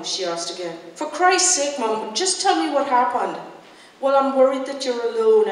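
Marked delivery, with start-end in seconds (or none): 0.9-3.4 higher, louder, impatient plea; frustrated outburst, raised voice; exasperated demand. A narrator's reading. Daughter demands the news from mother. 4.0-5.5 raised, louder worry; anxious, stalling reply; worried mother. A narrator's reading.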